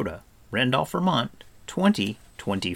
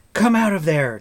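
loud, raised-pitch command; ordering; 7 semitones higher, 8 dB louder than usual. A narrator's reading. Taran ordering the stranger from behind the tree, loudly. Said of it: "Terran ordered".

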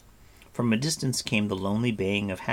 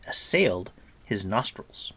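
plain reading voice

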